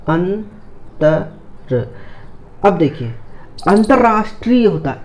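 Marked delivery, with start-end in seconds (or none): 0.1-1.9 slow spelling demo. Shown as a demonstration. Teacher spelling for students, very slow drawn-out word. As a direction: draw अंतर out very slowly, syllable by syllable. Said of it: "अंतर को हमने लिख लिया".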